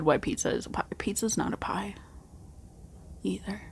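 hushed whisper. Real, not acted. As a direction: whisper the rant. Whispered pizza rant.

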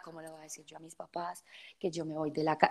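whispered, afraid to tell her parents; scared whisper. Retold or imitated. Dreading telling her parents, scared whisper. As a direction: hush it like a frightened secret. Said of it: "yo dizque asustada".

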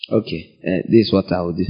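low, soft aside as a mock judge; lenient judge. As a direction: lightly act a judge quietly making an exception. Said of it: "no judge is gonna look at you and go".

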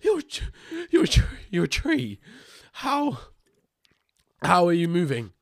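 high, stammering disbelief; freaking out. Acted. Adventurer scurrying backwards from a walking tree, freaking out. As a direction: break off and restart, pitch leaping in panic. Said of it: "just freaking out".

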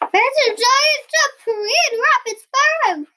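high, loud panicked squeal; alarmed. A narrator's reading. Frightened Elephant warns Mummy Frog of the intruder, high loud cry. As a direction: raise the alarm as a terrified elephant.

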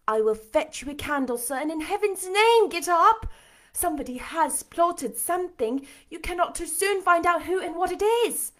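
high, insistent urging; urgent Jane character voice. A narrator's reading. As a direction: press him urgently, rising high. Jane urges Rochester to act.

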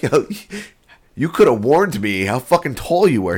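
amused mock protest; excited teasing. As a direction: rib him about the height. Teasing therapist about height.

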